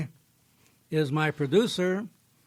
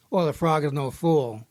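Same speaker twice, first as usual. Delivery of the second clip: ordinary delivery, nothing marked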